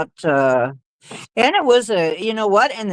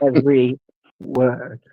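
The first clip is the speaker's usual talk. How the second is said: lower, separated words; slow, deliberate; 4 semitones lower than usual. Shown as a demonstration. Showing listeners would get each word, slow and deliberate. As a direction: set each word down on its own. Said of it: "she spoke slowly".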